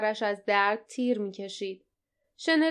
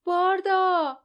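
loud high call across the water; desperate shout, name falling at the end; 9 semitones higher, 5 dB louder, much slower than usual. A narrator's reading. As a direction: call out for a friend who fell. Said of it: "فریاد زد".

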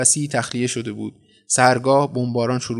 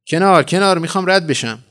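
loud, higher demand to clear the way; calling out; 5 semitones higher, 6 dB louder than usual. Acted. Mother pushing through a frightened crowd, calling out. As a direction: call out to part a crowd.

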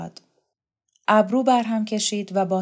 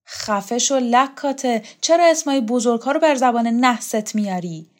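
acted indignant telling-off; angry scolding; 5 semitones higher, 6 dB louder than usual. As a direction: scold her, drawing out the first words. Scolding a woman in a quarrel.

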